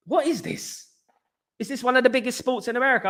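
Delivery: high, fast incredulous squeak; mock disbelief. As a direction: act baffled at the question, high and quick. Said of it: "I was like".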